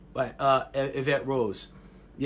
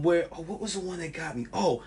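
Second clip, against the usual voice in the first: ordinary talk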